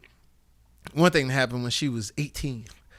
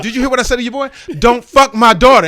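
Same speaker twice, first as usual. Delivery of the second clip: loud, high shouted warning; furious dad; 8 semitones higher, 15 dB louder than usual. Retold or imitated. Dad warning daughter's boyfriend, loud high shout. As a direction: bellow it at the boy.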